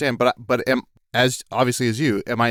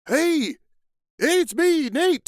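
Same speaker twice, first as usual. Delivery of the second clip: much higher, cheery call; high voice announcing himself; Nate's voice; slower than usual. Acted. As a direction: chirp it out as the dead man.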